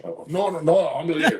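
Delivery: high, giggly imitated protest; laughing mock protest. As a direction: play it through a laugh. Imitating the comic exchange.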